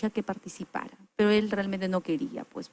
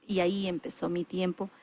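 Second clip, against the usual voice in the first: ordinary delivery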